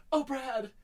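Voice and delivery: high, melodramatic lament; mock despair. Fan imitating Janet pining for Brad, mock despair. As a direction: lament it like an overwrought heroine. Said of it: "in despair and shit".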